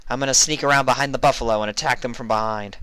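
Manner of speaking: eager scheming filly; lightly voiced plan, quick; Vinyl's voice. A narrator's reading.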